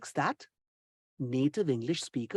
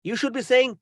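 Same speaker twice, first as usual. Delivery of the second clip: normal talking voice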